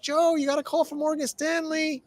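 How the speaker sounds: mimicking the wife calling him to the phone, pitched high; a high, lightly voiced wife calling across the house; wife passing the phone to her husband, high put-on voice. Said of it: "And she's like".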